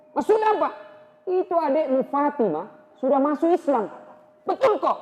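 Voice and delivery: high and loud, stunned; hearing his sister converted, high and loud; agitated shout. Acted. Shocked exchange about Fatimah.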